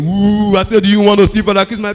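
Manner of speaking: spooky mock Santa, loud; scary Father Christmas. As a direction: frighten the child as Santa. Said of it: "You go do am again".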